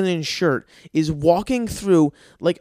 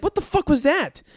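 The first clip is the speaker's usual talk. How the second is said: startled outrage; 11 semitones higher than usual. Retold or imitated. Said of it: "And I'm like".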